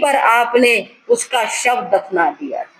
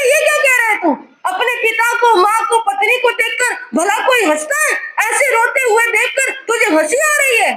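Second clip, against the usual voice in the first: much higher, sobbing and shouting; crying shout; 10 semitones higher, faster than usual. Acted. Father scolding his son through tears, high sobbing shout.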